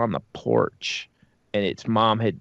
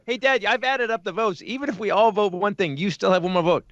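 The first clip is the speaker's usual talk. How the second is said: mock eager kid; 7 semitones higher than usual. Kid voiced presenting vote tally.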